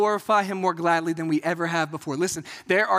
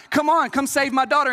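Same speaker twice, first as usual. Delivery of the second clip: urgent plea; 6 semitones higher, 4 dB louder than usual. Retold or imitated. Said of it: "he's like".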